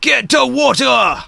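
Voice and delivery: high, slow urgent cry; giant voice. Acted. Giant running from a burning building, high drawn-out cry. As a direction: giant calling out with stretched words. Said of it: "a giant runs out of the building and says".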